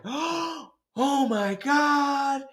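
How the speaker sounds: high, very drawn-out cry of shock; imitated horror. Ladies watching the driver push forward, horrified. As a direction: stretch it out like a scandalized onlooker.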